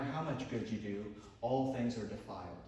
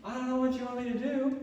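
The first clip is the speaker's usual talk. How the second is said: high, protesting dad voice; mock a husband's exasperated defence; hammed-up defensive dad, pitched up; imitating dad; 11 semitones higher than usual. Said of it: "Dad would be like".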